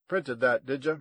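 lightly voiced ranger. A narrator's reading. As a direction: throw it at them as a loud challenge. Gunman ranger taunting Willow.

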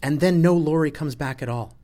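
loud, high outburst; agitated. A narrator's reading. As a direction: push the argument home at full voice. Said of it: "Sol gets loud when he's agitated".

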